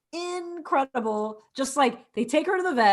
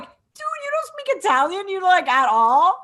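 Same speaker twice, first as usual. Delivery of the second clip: acted incredulity; 6 semitones higher, 5 dB louder than usual. Acted reaction to film character.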